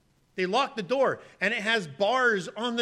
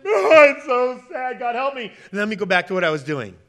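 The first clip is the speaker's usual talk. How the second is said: mock sorrow; 3 semitones higher, 11 dB louder than usual. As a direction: overplay a sinner's crocodile tears. Mocking insincere sinner's lament.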